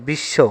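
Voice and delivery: বিশ্ব said as "bish-sho"; doubled consonant. Shown as a demonstration. Teacher gives an example of doubling, demonstrating "bish-sho" for বিশ্ব. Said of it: "দুটা তালব্য শ উচ্চারণ করতেছি".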